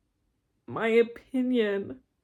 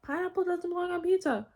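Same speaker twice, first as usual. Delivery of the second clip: mocking people; 8 semitones higher than usual. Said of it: "one of those cogs in the machine being like".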